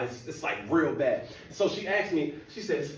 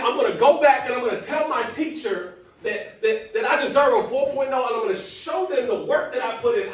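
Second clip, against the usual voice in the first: put-on higher, louder indignant girlfriend; girlfriend's voice; 12 semitones higher, 12 dB louder than usual. Girlfriend demanding her four point oh, higher indignant voice. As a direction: insist like she's been robbed. Girlfriend vows to confront teacher.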